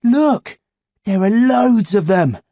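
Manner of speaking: put-on high, loud excited boy. A narrator's reading. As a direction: gush over the find. Boy excited finding cards.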